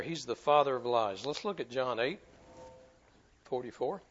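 normal talking voice

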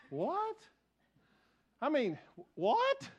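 high, disbelieving What? rising sharply; incredulous. Retold or imitated. Preacher recalling reading a pastor's doubt, incredulous. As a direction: react in disbelief to something absurd. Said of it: "and I'm like".

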